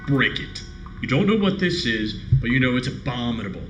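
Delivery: ordinary talk, nothing marked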